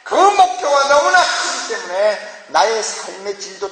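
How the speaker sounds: high, insistent, building sermon cadence; fervent preaching. Real, not acted. Preacher urging believers to change the world, fervent high-pitched preaching. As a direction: pile up each phrase with rising conviction.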